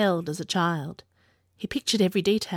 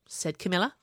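ordinary delivery, nothing marked

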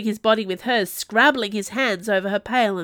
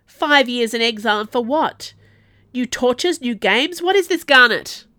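loud, raised, mounting outrage; anguished howl; 4 semitones higher, 8 dB louder than usual. A narrator's reading. Exiled madman railing about tortures and games, howling. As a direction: howl the questions, building to the last. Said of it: "the Ducomte howled".

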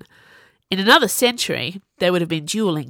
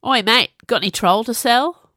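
loud, higher-pitched rough demand; 5 semitones higher, 5 dB louder than usual. A narrator's reading. Bro calling down to a supposed dealer, demanding. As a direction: call out a pushy question. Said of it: "one of them demanded".

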